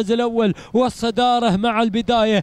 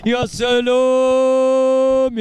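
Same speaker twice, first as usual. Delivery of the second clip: drawn-out cheer; 4 dB louder, much slower than usual. Real, not acted. Race commentator cheering the leading camel, long drawn-out يا سلام. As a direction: stretch يا سلام out long and a little louder, marvelling.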